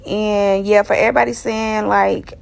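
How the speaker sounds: ordinary talk, nothing marked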